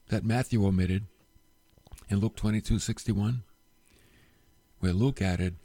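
ordinary delivery

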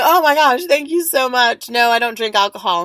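loud, high gushing thanks; effusive polite refusal. Retold or imitated. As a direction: turn it down with big gratitude. Politely refusing offered vodka.

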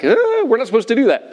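high, quick fretful objection; mock objector. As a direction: imitate a squeamish churchgoer. Mimics objecting Christians.

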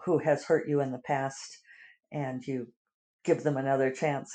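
normal talking voice

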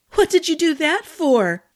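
indignant outburst. Acted. Arden shoved into the pond protests at Dylan, indignant cry. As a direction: splutter it out, outraged.

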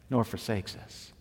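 ordinary talk, nothing marked

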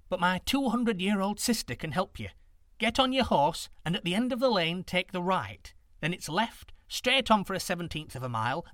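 put-on high old woman giving directions.

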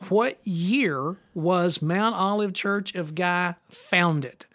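slowed-down quiz question; slow and deliberate. Shown as a demonstration. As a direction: read each word out slowly. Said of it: "repeats question slowly".

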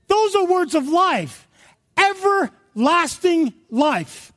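high, fervent pulpit shout; full-force preaching. Real, not acted. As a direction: hammer it home, drawing out the key words.

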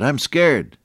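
put-on small child blurting out a fear; little boy. Little boy to his sister at bedtime, scared. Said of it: "the little boy said".

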